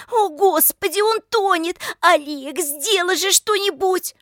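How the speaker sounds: high, loud alarm; panicked plea. A narrator's reading. Lika begging Oleg as Pavlik drowns, high panicked plea. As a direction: beg for help in a high voice.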